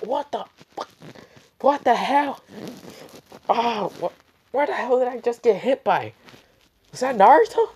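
very high, hammed-up alarm; falsetto-high mock panic after being hit; shocked outburst. Kakashi reacts to being shot.

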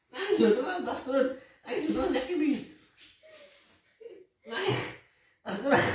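high-pitched, giggling disbelief; laughing while talking. Real, not acted. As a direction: squeal it through laughter. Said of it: "saya sambil ketawa ini". (bicara nada tinggi sambil tertawa)

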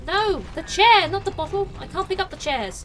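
high, loud outburst of protest; frustrated shout. Pretend. Player grabbing the bottle instead of chair, frustrated shout. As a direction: shout at the wrong pick. Picked up the wrong object.

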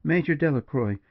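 lifted, heartfelt exclamation; exclaimed cry. A narrator's reading. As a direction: cry the name out with feeling. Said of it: "she cried".

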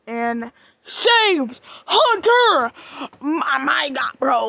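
loud and drawn out; thrilled shout. Real, not acted. Fan cheering as mom saves Hunter, loud. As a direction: cheer the rescue.

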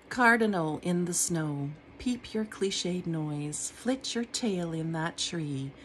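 measured, unhurried poem reading; poetry reading. Acted. Reading her own poem aloud to viewers, measured and unhurried. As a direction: let each image land with a pause.

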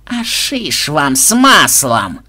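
put-on slow, drawn-out old villain's grumble; grumbling refusal. A narrator's reading. Koschei refusing the players' demand to die, in a slow grumble. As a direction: grumble it out slowly, refusing outright. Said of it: "проворчал Кощей".

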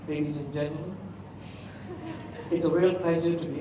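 mock dull lectern speaker, soft and droning; a hint of a tired speech-giver reading his opening; stiff boring speaker. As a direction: lightly imitate a bored podium speaker. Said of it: "the guy was talking like this".

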